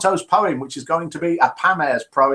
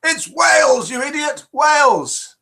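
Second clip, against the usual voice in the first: much higher, loud furious screech; angry screech; 12 semitones higher, 4 dB louder than usual. Retold or imitated. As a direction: screech it like an offended Welsh woman. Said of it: "One of them angrily screeched".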